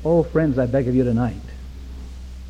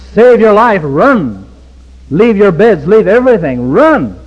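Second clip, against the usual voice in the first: louder shouted warning to flee; 6 semitones higher, 7 dB louder than usual. Retold or imitated. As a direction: holler it like a police officer warning people. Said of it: "scream and holler and warn and shout".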